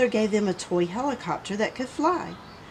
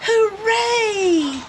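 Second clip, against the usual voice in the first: very high, loud cheer; 13 semitones higher, 5 dB louder than usual. A narrator's reading. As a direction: cheer with joy. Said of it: "cheered Raz".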